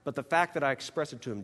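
ordinary talk, nothing marked

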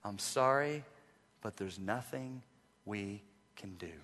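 a doctor quietly giving terrible news; grave doctor's news. Retold or imitated. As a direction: break it gently, trailing off. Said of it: "the dreaded words that no physician wants to say".

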